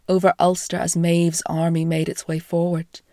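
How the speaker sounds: usual speaking voice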